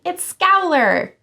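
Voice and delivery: slow, high, loud alarmed cry; high slow panicked yell. A narrator's reading. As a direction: shout the name in terror, drawn out. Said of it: "yelled Happy".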